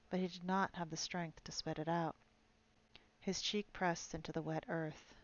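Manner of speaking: steady audiobook reading of a fantasy novel; story narration. Acted. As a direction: read the chapter out like an audiobook. Reads Witcher novel aloud.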